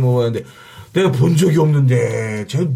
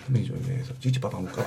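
much softer, lower, hurried aside; 은밀한 귓속말 흉내; 7 semitones lower, 13 dB quieter, much faster than usual. Retold or imitated. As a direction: mimic a secret word slipped to an accomplice. (몰래 속삭이듯 낮고 빠르게)

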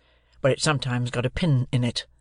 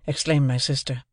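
plain reading voice

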